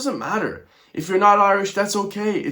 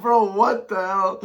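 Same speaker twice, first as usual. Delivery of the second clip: shocked laugh; 7 semitones higher than usual. Real, not acted. Reacting to shocking clip line.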